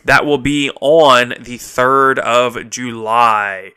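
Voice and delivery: loud, booming announcer read; radio announcer voice. Shown as a demonstration. Host reading the tournament ad in announcer voice. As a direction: sell it like a radio promo. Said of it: "in my best, uh, radio announcer voice".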